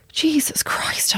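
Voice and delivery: slow, high whispered disbelief; stunned whisper. Retold or imitated. Retold shocked reaction to refusal.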